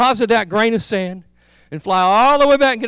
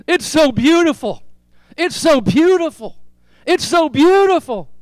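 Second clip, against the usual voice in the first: mock ecstatic cry, higher and louder; retold joyful yelling; 7 semitones higher, 5 dB louder than usual. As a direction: call it out in rapture, rising each time. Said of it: "he began screaming and yelling".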